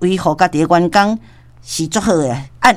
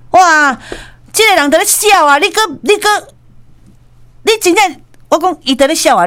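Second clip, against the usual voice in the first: indignant outburst; 7 semitones higher than usual. Retold or imitated. Husband outraged at the laugh.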